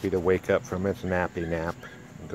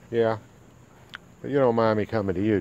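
ordinary talk

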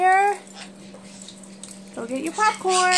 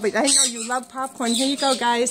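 ordinary delivery

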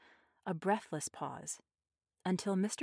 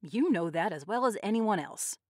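ordinary delivery, nothing marked

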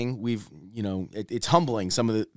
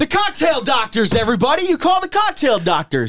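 infomercial announcer; 9 dB louder than usual. Pretend. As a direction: sell it like a cheesy TV ad. Hammed-up TV ad voice.

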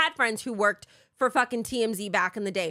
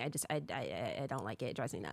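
much softer and lower, trailing off; fading to quiet; 12 semitones lower, 12 dB quieter, faster than usual. Real, not acted. Confessing how it hurts her, fading quiet. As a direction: let it die away, worn down. Emotional about dismissed humanity.

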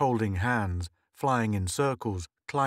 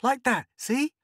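put-on showing off girl; 14 semitones higher, 4 dB louder than usual.

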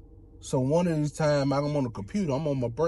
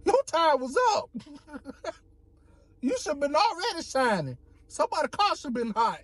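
voicing the sun; 12 semitones higher than usual. The sun telling him his time was up, high mock scold.

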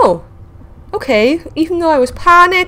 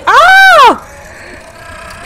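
sudden high, loud shriek of fright; startled scream; 6 dB louder than usual. Real, not acted. Gamer jumps at a sea creature, shrieking scream. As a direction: yelp as something lunges at you.

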